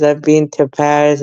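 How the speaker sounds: ordinary talk, nothing marked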